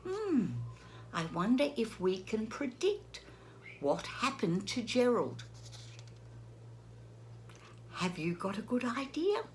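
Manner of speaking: ordinary delivery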